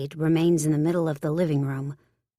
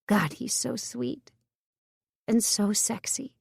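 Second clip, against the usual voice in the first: a higher, slow, softened sigh of longing; tender inner voice, softer and slower; 5 semitones higher, 3 dB quieter, slower than usual. A narrator's reading. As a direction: melt a little over him. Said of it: "I soften".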